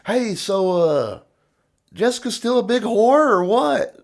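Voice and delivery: put-on high, needling drunk taunt; mocking barfly taunt. Drunk buddy taunting a stranger at the bar, high needling. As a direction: poke at him with a sing-song jab. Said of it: "he'd be like".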